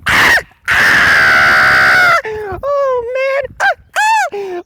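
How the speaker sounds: high, loud hammed-up screams of Dante; mock screaming. Mocking Dante's freakout scene, hammed-up screaming. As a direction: imitate a man losing his mind, overdone. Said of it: "this man losing his mind".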